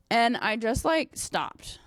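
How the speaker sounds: normal talking voice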